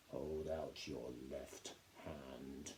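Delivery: put-on captain ordering boy before caning; cold quiet order. A narrator's reading. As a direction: menace in every word.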